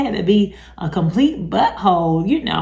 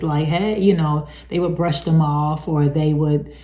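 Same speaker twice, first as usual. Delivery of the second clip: ordinary talk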